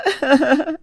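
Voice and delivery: short wicked giggle; gleeful laugh. Acted. Boy giggling to his father about the kitten. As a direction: giggle proudly over the dead kitten.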